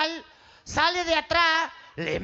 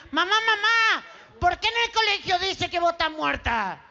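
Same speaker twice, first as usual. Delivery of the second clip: put-on child calling mom; 5 semitones higher than usual. Child calling mother in joke.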